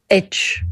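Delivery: H as short "etch". Shown as a demonstration. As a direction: say H like "etch" after the alphabet run. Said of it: "you say H".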